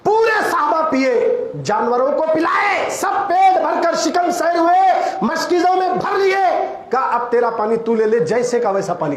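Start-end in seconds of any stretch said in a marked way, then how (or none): 0.0-6.7 fervent pulpit cry; preaching at full force. Acted. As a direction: call it out to the whole congregation. Preacher rises to climax.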